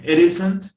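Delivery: teacher models it, ISN'T said loud and rising; stress on isn't. Shown as a demonstration. Teacher showing the student the stressed isn't. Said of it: "Eso está bien".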